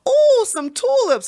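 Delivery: high, drawn-out ooh of discovery; mock delight. Imitating centrists who find tulips for their basket, mock delight. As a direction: coo as if spotting treasure. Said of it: "they'll go".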